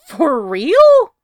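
high, loud disbelieving cry; voice up an octave. Acted. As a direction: shoot it up high in shock. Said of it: "Pete's voice goes up an octave".

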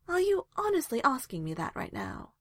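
high-pitched incredulous question; exasperated. A narrator's reading. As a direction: ask it with an eye roll. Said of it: "rolling her eyes".

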